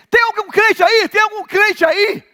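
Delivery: high, loud call for a believer. Retold or imitated. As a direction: shout it across the street crowd. Woman calling out in crowd. (grito chamando um crente)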